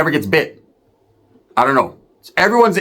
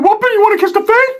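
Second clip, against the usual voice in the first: very high, squeaky mock Ricky; 3 dB louder than usual. Ricky egging the homies on, very high. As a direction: squeak it like a mocking cousin.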